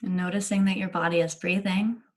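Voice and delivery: unhurried guiding voice with long pauses between phrases; calm meditation leader, letting each phrase settle; guided meditation. Acted. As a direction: gently guide listeners into body awareness, leaving lots of space.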